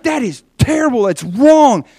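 high, loud, outraged condemnation; erupting teacher. Retold or imitated. Teacher condemns the student's answer, loud outrage. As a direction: hammer the verdict at full pitch. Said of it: "erupts out of her chair".

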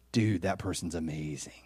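low, soft, impressed murmur; hushed aside of amazement; awed onlooker. Retold or imitated. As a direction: say it under the breath in admiration. Onlookers' awed remark, acted.

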